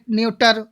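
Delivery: "न्यूटर" with a yu glide and hard T, no CH; common misreading. Shown as a demonstration. Teacher showing a common mistake with nature, nyu-tar misreading. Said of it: "बहुत होता है ना".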